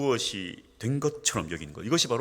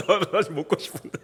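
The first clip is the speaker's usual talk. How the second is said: laughing mock sulk; 5 semitones higher, faster than usual. Mock disappointment after refusing.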